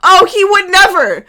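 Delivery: sarcastic oh he would never; mock denial. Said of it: "Jay went".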